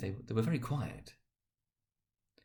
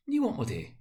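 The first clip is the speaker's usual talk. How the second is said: lightly voiced wife; 8 semitones higher than usual. Wife's puzzled question.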